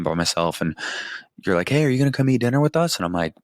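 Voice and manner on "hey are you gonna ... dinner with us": high, bright call from another room, quick. Retold or imitated. Wife calling the husband in to dinner, a high quick call. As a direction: sing it out louder to reach him.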